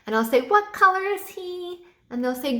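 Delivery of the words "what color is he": higher, slower playful question; bright teacher question. Shown as a demonstration. Teacher asking kids about the dinosaur, bright and slow. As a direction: ask it like a game show host for kids. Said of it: "you have to be very enthusiastic".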